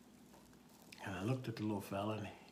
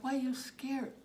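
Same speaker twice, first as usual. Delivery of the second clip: raised-pitch concern; gentle question to child; 13 semitones higher, slower than usual. Retold or imitated. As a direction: ask a small child up high. Officer asking a frightened child.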